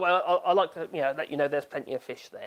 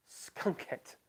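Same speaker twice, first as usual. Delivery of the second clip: unvoiced puzzled whisper of the name; whispered Skunkette; whispered repeat; 11 dB quieter than usual. Real, not acted. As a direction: breathe the name in disbelief. Rereading an odd username.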